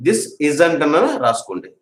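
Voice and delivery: usual speaking voice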